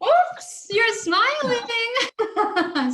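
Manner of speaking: high, loud playful tease; a high teasing "you're smiling"; teasing gotcha. Acted. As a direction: catch him out gleefully, slowly.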